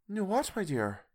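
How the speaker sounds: high-pitched wife's puzzled question. A narrator's reading. Wife questions Bob in story.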